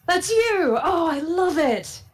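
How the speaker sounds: high, thrilled squeal of recognition; very excited. Acted. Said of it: "He's very excited".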